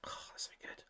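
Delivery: dreamy breathless whisper; hushed longing for beans. Real, not acted. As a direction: whisper it like a secret craving. Savoring food, dreamy whisper.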